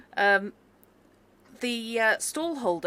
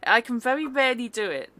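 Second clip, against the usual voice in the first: put-on Welsh accent; 4 semitones higher, 7 dB louder than usual. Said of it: "I love that Welsh accent so much".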